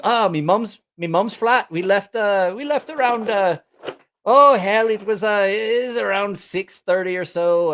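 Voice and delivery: high, halting mock British accent; botched British accent. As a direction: try British, drift toward Middle Eastern. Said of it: "Why do you sound like you're Middle Eastern".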